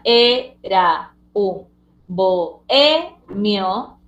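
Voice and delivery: "era un bohemio" very slow, e, ra, un, bo, e, mio; syllable by syllable. Shown as a demonstration. Teacher giving the correct answer, "era un bohemio" in separated syllables. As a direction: pause after each syllable of "era un bohemio". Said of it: "la respuesta con el correcto sílabeo".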